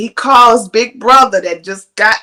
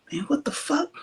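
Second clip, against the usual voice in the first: whisper; 7 semitones higher, 12 dB quieter than usual. Retold or imitated. Boyfriend muttering at his phone.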